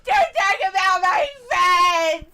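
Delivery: slow, loud, high fake-sobbing yell; mock crying shout. Streamer imitates a sobbing wrestler with a hammed-up crying shout. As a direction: wail it like a crybaby, hammed up.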